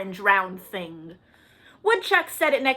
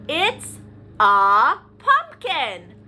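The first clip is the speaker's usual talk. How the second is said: title reveal; 5 dB louder, much slower than usual. Shown as a demonstration. Announcing the book title.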